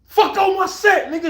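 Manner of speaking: loud, high-pitched angry yell; furious retold outburst. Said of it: "He's furious saying it".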